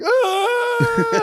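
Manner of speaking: very high, loud held squeal; mimicked kid's piercing cry; imitated child's wail. Daughter wailing in the dark at bedtime, mimicked squeal. Voices daughter crying out after whack.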